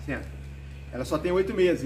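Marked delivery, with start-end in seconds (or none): 0.0-0.4 soft low sit command; talking to a dog. Real, not acted. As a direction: quietly tell the dog sit. Command to the dog mid-talk.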